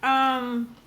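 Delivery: talking around chewed ice; crunching ice while speaking; ice in mouth. Real, not acted. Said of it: "sorry, I'm chewing on ice".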